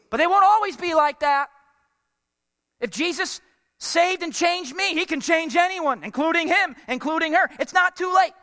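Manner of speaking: high, loud preacher's exhortation; impassioned preaching. Real, not acted. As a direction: drive the hope home at full pitch. Preacher builds to impassioned encouragement.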